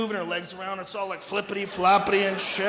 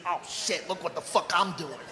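hushed crazy-guy voice; crazy doctor; 4 semitones lower, 8 dB quieter than usual. Acted. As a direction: say it softly, playing a crazed surgeon. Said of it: "he was kinda crazy".

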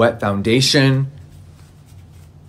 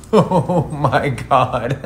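ordinary talk

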